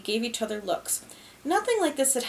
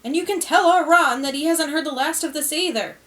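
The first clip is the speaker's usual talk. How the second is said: higher and louder angry call; yelling back through the door; shouted from the hall; 5 semitones higher, 8 dB louder than usual. A narrator's reading. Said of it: "her muffled voice echoed from the hallway".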